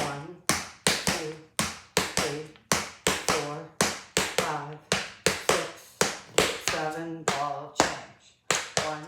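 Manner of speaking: loud rhythmic counting; counting out steps. Shown as a demonstration. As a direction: call the counts like a tap teacher. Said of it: "you can watch it and listen to it".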